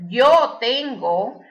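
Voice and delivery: slow, carefully spaced Spanish sentence; slow teacher's model answer in Spanish. Shown as a demonstration. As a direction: say the sample answer slowly and clearly.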